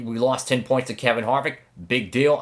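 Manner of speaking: ordinary talk, nothing marked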